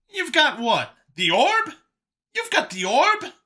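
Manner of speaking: very high, incredulous questions; astonished. Acted. Brian hearing Vincent has the orb, astonished. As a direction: shoot the pitch up in disbelief.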